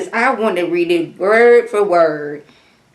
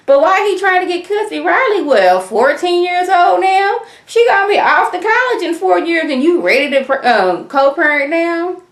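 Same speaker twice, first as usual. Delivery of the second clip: incredulous; 10 semitones higher than usual. Real, not acted. Mocking Block's sudden parenting.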